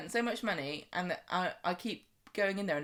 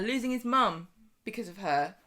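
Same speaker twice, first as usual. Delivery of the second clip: ordinary delivery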